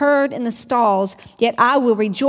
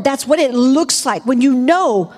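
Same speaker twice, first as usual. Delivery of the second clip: ordinary talk, nothing marked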